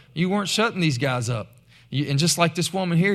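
ordinary talk

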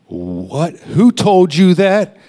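sharp, loud and high, slowing down on who told you; a coworker caught off guard, rounding on him; angry outburst. Retold or imitated. Coworker demands who told him, angry shout. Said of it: "He got angry".

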